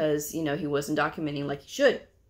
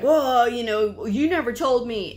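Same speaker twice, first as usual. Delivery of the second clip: raised, louder mock complaint; retold yelling; 6 semitones higher, 5 dB louder than usual. Upset provider to the coders, retold yelling. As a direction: imitate an indignant provider, mildly heated. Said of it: "he's, he's yelling".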